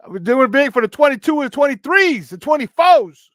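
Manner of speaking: hyped-up shout. Real, not acted. Hyped correction of show's decade.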